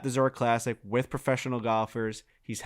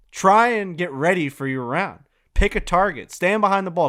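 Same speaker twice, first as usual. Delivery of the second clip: higher, louder barked instructions from the coach; coach commands; 6 semitones higher, 6 dB louder than usual. Retold or imitated. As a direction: bark the drill like a coach. Coach giving orders on range.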